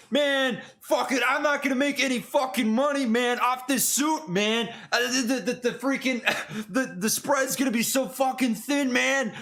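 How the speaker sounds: high-pitched, exasperated Boston tailor; imitating a griping thick-accented Boston guy. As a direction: complain like a put-upon Bostonian, pitched well up. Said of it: "This guy's got a thick Boston accent".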